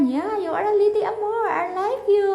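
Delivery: high, bright American tourist accent imitated. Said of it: "Sobrang slang niya". (ginagaya ang American accent ng Amerikana)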